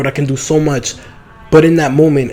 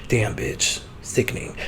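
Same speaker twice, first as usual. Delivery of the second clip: hushed impressed aside; 4 semitones lower, 13 dB quieter, slower than usual. Retold or imitated.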